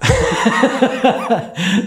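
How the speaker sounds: high burst of laughter; real chuckling at a botched word; amused laugh. Real, not acted. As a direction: laugh at your own slip of the tongue. Laughs at his mispronounced word.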